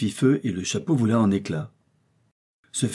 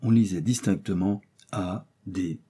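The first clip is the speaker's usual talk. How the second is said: ordinary delivery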